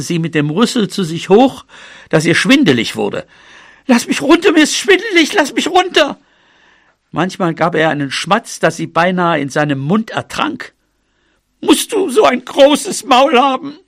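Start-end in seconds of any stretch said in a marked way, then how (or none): 3.9-6.2 very high-pitched panicky little mouse; alarmed cry. Acted. Dizzy mouse hoisted up cries out. 11.6-13.8 high-pitched scolding mouse; indignant. Acted. Mouse scolds elephant after wet kiss.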